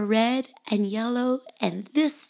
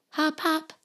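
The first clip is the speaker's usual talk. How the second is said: bunny voice; 8 semitones higher, 4 dB quieter than usual. Acted.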